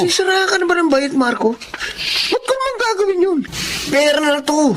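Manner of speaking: mock him for refusing easy money; scornful disbelief. Scoffing at friend's refusal.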